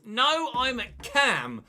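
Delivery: drawn out as if he can't believe it; surprised. Real, not acted. Gamer surprised to play central, slow and pleased.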